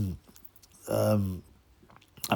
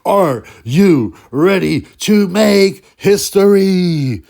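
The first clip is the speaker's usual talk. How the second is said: slow, very loud, high announcer boom; milk it like a ring announcer; announcer catchphrase; 7 semitones higher, 11 dB louder, much slower than usual. Retold or imitated. Mocking Big Mo's new catchphrase, slow and booming. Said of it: "He's changed it to".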